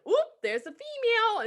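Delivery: high, perky little "oop" of discovery; excited sloth voice. Retold or imitated. Sloth voiced spotting a female.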